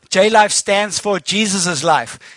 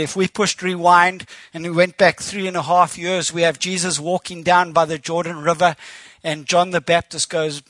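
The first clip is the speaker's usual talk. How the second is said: normal talking voice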